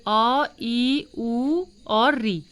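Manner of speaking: short vowels अ ई उ री, each sounded crisply; short vowel drill; short vowels demo. Shown as a demonstration. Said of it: "इनके उच्चारण में कम समय लगता है".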